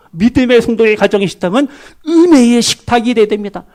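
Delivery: ordinary talk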